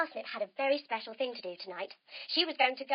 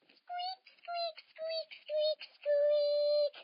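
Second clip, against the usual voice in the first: very high tiny squeaks; mouse squeaks; 14 semitones higher, much slower than usual. Acted. Storyteller squeaking as the mouse creeps up the wire. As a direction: squeak like a sneaky little mouse.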